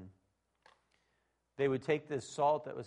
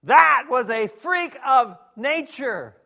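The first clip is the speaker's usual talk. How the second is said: high, loud, slow shout of shock; shocked yell; 11 semitones higher, 23 dB louder, much slower than usual. Pretend. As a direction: belt out the shock at the freak dog. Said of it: "You're gonna say".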